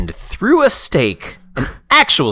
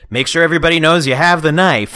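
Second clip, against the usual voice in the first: bossy director voice; 8 semitones higher, 8 dB louder than usual. Retold or imitated. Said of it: "the director was like".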